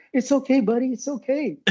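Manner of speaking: high, mock-soothing imitation of a buddy; fake-sympathetic consoling. Prankster consoles his freshly dumped buddy, imitated high and mock-sympathetic. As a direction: comfort him while barely holding back a smile. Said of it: "trying to hold a smile back".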